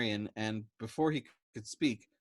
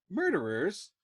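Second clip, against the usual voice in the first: higher, louder echo; affronted; 8 semitones higher, 5 dB louder, slower than usual. A narrator's reading. Dobbs echoing Yossarian, higher and affronted. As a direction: echo it, offended. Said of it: "Dobbs was affronted".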